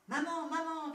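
high-pitched excited call for mother. A narrator's reading. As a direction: call out eagerly on arriving home. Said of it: "cria-t-elle en arrivant".

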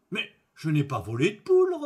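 loud, indignant innkeeper protest; acted outraged denial. A narrator's reading. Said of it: "protesta l'aubergiste".

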